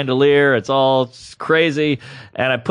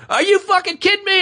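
high-pitched imitation of an outraged woman; mock flipping out; 14 semitones higher than usual. Woman learning it was all made up, imitated in high outrage. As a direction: squeal it in mock disbelief. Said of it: "she, like, flipped out".

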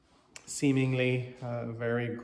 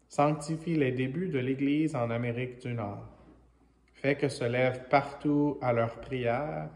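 louder, measured liturgical proclamation; prayer reading in French; 4 dB louder than usual. Real, not acted. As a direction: proclaim a prayer to God, phrase by phrase. Reads the French prayer aloud.

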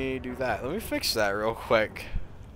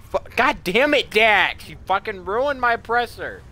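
angry outburst; 11 semitones higher, 7 dB louder than usual. Real, not acted. Swearing at teammate in game.

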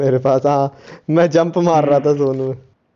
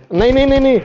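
panicked no; 11 semitones higher, 4 dB louder, faster than usual. Real, not acted. Teammates dying in a fight.

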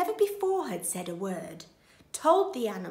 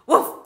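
short, very loud bark; dog woof; 12 dB louder than usual. A narrator's reading. Dog hears of the plot, loud barking. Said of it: "said the dog".

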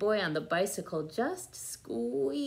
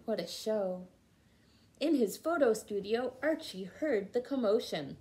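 plain reading voice

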